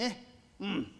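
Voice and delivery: normal talking voice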